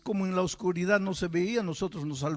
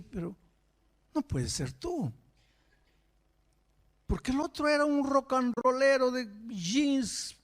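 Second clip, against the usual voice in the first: amazed disbelief; 7 semitones higher than usual. Real, not acted. Marvelling at the boy's change.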